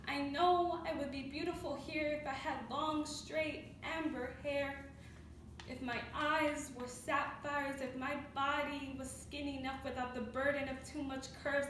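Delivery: subdued spoken word recital; hushed poetic longing. Acted. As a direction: start the stanza quietly and let each phrase sit. Final stanza starts hushed.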